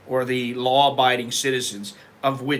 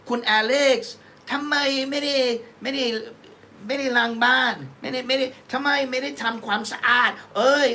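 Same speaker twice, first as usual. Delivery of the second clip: much higher, rapid nagging in Thai; imitating a Thai speaker nagging; 9 semitones higher, much faster than usual. A man imitating someone nagging him in Thai about the cleaning. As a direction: rattle off the complaint in an impersonated Thai voice. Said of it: "they're like".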